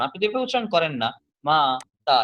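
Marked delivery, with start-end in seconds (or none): 1.4-2.2 মাতা pulled apart as মা, then তা; split into syllables. Shown as a demonstration. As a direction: pause inside মাতা after a firm মা. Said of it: "এভাবে তো উচ্চারণ করেন".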